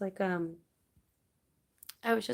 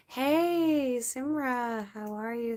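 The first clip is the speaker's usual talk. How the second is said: bright high greeting; 6 semitones higher, 5 dB louder, slower than usual. Real, not acted. Streamer greeting a viewer who joins, high and loud. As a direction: call out hey high and loud.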